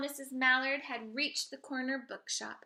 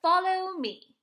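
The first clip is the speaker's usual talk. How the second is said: mother duck calling; 9 semitones higher, 13 dB louder than usual. Acted. Mother duck calls her ducklings.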